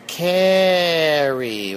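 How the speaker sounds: "Carrie" with long, stretched A and R sounds; elongated name. Real, not acted. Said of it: "with lots of A's and lots of R's".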